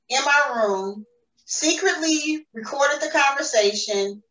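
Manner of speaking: usual speaking voice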